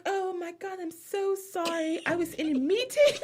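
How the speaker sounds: very high put-on mixed accent; Dorit's fake mixed accent. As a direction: apologize airily in a high, hammed-up fake accent. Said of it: "every time I do her impression".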